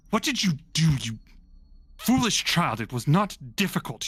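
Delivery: sharp scolding. Acted. Granny Morta to her apprentice over a mistake, scolding. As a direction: scold a foolish child, pitch jumping up.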